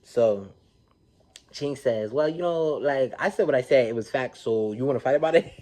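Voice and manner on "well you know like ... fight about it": louder, higher imitation of a defiant challenge; mock a brazen dare, laughter breaking in at the end; don't-give-a-fuck challenge. Vlogger retelling a castmate squaring up to fight, defiant imitation. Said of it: "she just don't give a fuck".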